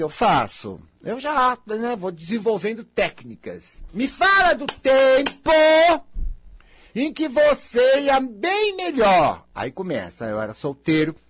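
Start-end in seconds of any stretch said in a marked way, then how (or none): 3.9-9.5 higher, slow, louder coaxing prompt; mock counsellor prompt. Shown as a demonstration. Asking a client about better times, slow coaxing prompt. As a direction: draw the client out slowly. Acts his counselling technique.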